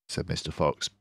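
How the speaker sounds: usual speaking voice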